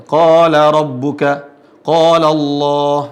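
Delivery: QALA chanted slowly with a heavy, long "qaa"; thick long alif. Shown as a demonstration. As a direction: stretch the thick vowel in each qala. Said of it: "Dan tebal. La, sama seperti". (tiap qala dilantunkan tebal dan panjang)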